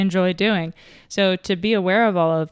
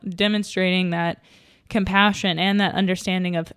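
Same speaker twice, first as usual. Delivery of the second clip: ordinary delivery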